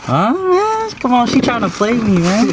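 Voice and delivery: put-on high, teasing girly voice; playful high girl voice.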